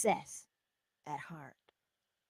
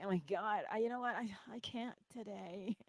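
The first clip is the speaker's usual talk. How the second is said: weary defeated mumble; 3 semitones higher, 13 dB quieter than usual. Retold or imitated. Acting out a discouraged morning.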